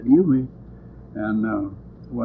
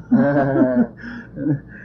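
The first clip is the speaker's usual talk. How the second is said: laugh; 9 semitones higher than usual. Real, not acted. Laughing at the insult.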